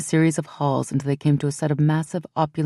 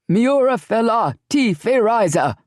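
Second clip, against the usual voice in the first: higher, louder chanted shout; shouted reply; 7 semitones higher, 3 dB louder than usual. Acted. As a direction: warriors roar the salute back. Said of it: "the other four shouted back".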